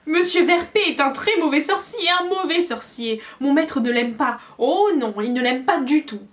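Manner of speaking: high, loud indignant elf; angry squeaky elf. A narrator's reading. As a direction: scorn for her master's rival. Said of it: "elle parut à nouveau en colère".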